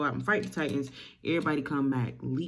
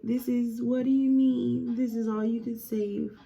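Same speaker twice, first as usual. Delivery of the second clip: higher-pitched mock maternal shock; mildly acted disbelief; 6 semitones higher than usual. Mother handed her son's arm, acted disbelief. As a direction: play a mother's stunned questioning, lightly.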